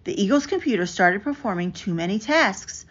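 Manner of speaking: plain reading voice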